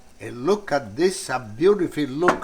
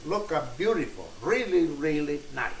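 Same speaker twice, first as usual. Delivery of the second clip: ordinary talk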